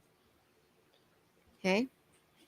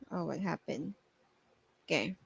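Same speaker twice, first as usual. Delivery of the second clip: ordinary delivery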